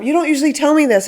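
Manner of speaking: imitating a parent, high, loud and fast; overexcited parent. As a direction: gush at the kid, fast and over the top. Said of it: "something that parents do sometimes is like".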